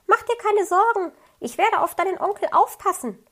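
high chirpy bird promising to help; a small bird reassuring a worried fox cub, high; little bird voice. A narrator's reading.

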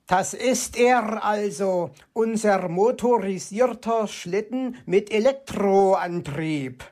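slow, proud raven voice. A narrator's reading. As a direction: present it with pride, slowly. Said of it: "erklärte Meister Schwarzrock stolz". (stolze, langsame Rabenstimme)

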